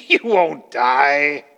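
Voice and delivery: slow, drawn-out sarcastic denial; mock them as you slowly stretch it out. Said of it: "Don't you sense the sarcasm?".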